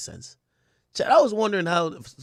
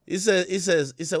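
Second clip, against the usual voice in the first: ordinary talk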